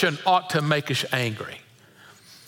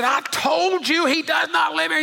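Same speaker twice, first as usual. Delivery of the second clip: much higher angry shout; really mad; 10 semitones higher than usual. Retold or imitated. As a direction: yell it down the phone, fed up. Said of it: "the guy got really mad".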